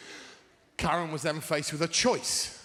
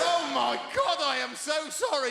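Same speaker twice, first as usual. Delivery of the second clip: horrified apology; 10 semitones higher than usual. Retold or imitated.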